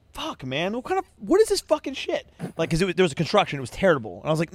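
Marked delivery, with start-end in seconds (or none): 0.1-2.3 higher-pitched exasperated swearing; retold frustrated outburst. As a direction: replay your annoyance at the detour.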